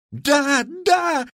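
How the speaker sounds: very high, loud yes-yes; crowd shouting. A narrator's reading. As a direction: shout eagerly from the front rows. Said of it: "закричали". (громкий высокий выкрик «да, да»)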